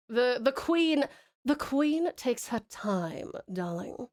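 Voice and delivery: slow, drawn-out posh British queen voice; unhurried posh British drawl, lingering on darling. Shown as a demonstration. Posh British queen voice, coaching a viewer on her character. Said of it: "drag everything out. Don't talk very quickly".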